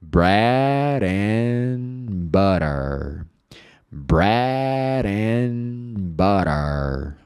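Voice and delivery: "Bread and butter" very slow, "brear an butter" with a tapped R; slow linked demo. Shown as a demonstration. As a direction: stretch "Bread and butter" into one flowing line. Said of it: "aqui vai sair assim". ("Bread and butter" bem devagar, tudo ligado)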